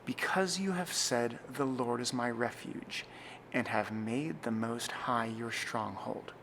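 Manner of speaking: slow, pausing at comma and midpoint; solemn, spacious psalm reading; slow liturgical reading. Shown as a demonstration. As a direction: read deliberately for the congregation to follow. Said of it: "I'll read it through a few times again".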